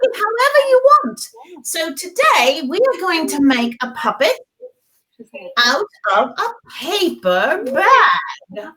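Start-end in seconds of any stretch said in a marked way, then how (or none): none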